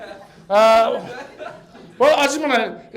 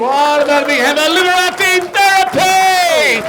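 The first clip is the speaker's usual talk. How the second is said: loud, high show announcement; rousing host shout; 8 semitones higher, 9 dB louder than usual. Real, not acted. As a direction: whip up the applause for an act. Host calls applause for sponsor.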